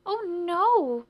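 high, loud, drawn-out alarm; crying out. A narrator's reading. Old lady stops the policeman pulling the bush, alarmed cry. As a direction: wail it in protest. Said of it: "cried the old lady".

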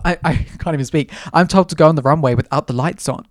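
ordinary talk

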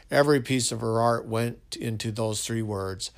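usual speaking voice

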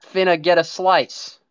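put-on old Italian-American guy, finna; Italian-American accent. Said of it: "a sixty-three-year-old Italian man".

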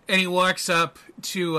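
ordinary talk

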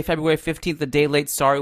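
ordinary delivery

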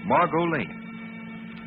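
ordinary delivery

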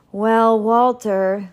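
slow, loud, drawn-out opening; hesitant pondering. A narrator's reading. Duke reconsidering banishing the baker, hesitant pondering. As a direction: stretch it out while thinking it over. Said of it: "the Duke started to say".

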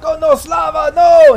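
very high, loud cry of dismay; anguished yell. Acted. Game master as thug seeing his comrade fall, anguished high yell. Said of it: "the other guy yells out".

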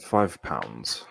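a mock British correction; put-on British accent. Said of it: "this is British".

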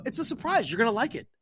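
high-pitched, teasing placating voice; coy retold reassurance. Placating a worried friend about a surprise, coy. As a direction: pitch it way up, keeping the secret.

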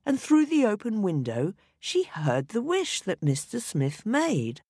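plain reading voice

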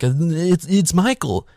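high-pitched mock alarm; mock panic. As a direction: imitate a crowd's horrified recognition. Voicing a panicked crowd recognizing Michael.

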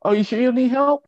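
high-pitched, insistent saleslady; cosmetics lady. Retold or imitated. Cosmetics lady pressing a young shopper, mock high-pitched helpfulness. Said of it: "the lady there doing the cosmetics".

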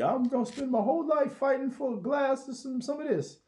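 high, whiny mock activist; mock activist whine. Mocking activists, a high whiny complaint. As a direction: whine like a self-pitying activist.

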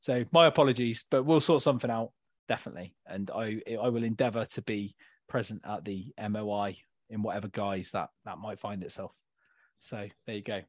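low, hushed, sheepish admission; subdued apology. Real, not acted. Co-host apologises to friend for his poor admin, subdued. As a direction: own up quietly, voice sinking low.

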